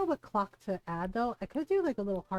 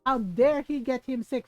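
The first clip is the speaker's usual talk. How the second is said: mock indignation, higher and louder; imitating mad mom; 4 semitones higher, 6 dB louder than usual. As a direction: play an affronted mother. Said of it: "She was so mad".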